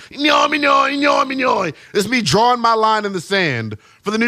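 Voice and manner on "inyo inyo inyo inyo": channel a scribbled cartoon creature babbling; Doodle Bob impression. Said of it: "do my best Doodle Bob impersonation".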